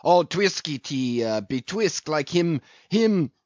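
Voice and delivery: excited, disjointed groping; faltering for a word. A narrator's reading. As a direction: stumble and repeat, hunting for the word. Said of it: "faltering hard for a word".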